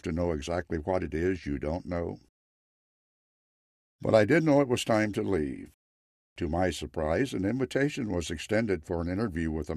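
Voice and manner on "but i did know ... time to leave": plain reading voice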